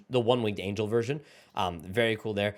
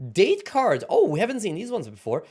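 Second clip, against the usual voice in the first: excited; 8 semitones higher, 5 dB louder than usual. Real, not acted. New card type revealed.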